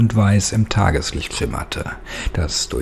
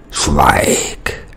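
ordinary delivery

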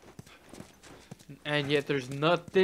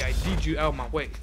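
ordinary delivery

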